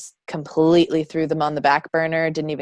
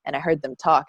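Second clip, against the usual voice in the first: ordinary delivery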